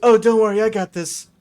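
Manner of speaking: eager helper. Acted. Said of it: "Sam goes".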